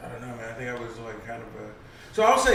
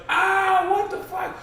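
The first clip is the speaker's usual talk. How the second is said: much higher, louder yelp of pain; hammed-up pained shriek; retold pained yell; 4 dB louder, slower than usual. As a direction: imitate a guy whose hand got slammed in a door. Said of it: "Buddy's like".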